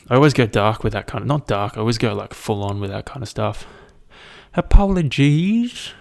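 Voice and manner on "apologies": mock apology. Mock apology after dark joke.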